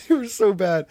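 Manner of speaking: high, loud, through laughter; laughing disbelief. Real, not acted. Recalling awful radio prank calls to a friend, laughing. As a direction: crack up as you say it.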